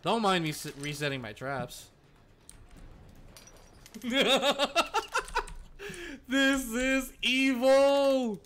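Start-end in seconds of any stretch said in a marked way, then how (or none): 3.9-6.3 gleeful villain cackle, higher; evil laugh. Real, not acted. Streamer gloating over his trap, cackling. 6.3-8.4 slow, drawn-out, higher and louder; gleeful. Real, not acted. Streamer revelling in his trap to chat, slow gleeful.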